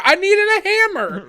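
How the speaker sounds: high, loud pleading; imitated indignant whine; mock protest. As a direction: argue desperately for a prized tool. Retold protest to cop.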